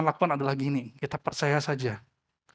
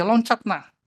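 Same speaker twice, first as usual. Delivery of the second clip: higher, firm call to jump; father urging; 6 semitones higher, 4 dB louder, slower than usual. A narrator's reading. Father calls up to his frightened child, urging. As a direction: push the child to leap, half-shouted. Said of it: "Papanya berkata sama dia".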